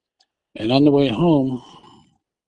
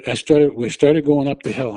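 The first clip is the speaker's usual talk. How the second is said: ordinary talk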